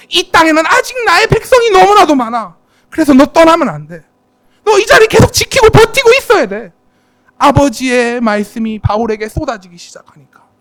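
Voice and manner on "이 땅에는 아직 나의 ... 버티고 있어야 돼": higher and louder, building; rally him to stand firm; urgent exhortation. Pretend. God urges Paul not to leave Corinth, rising exhortation.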